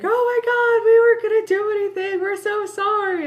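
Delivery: high squealing panicked apology; panicked frat boys. Retold or imitated. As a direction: blurt it like terrified frat boys begging. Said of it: "freaking out, like".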